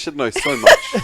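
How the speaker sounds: squeaky high words through laughter; laughing. Real, not acted. Teasing a friend's mum, squealing with laughter. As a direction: break into a giggle on it. Joke about mum's memory.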